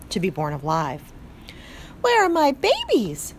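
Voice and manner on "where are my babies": high weary mother mouse asking for her babies; breathless after the birth, high and pleading; exhausted mother. A narrator's reading. Mother mouse after giving birth, high and weary. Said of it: "said the exhausted mother".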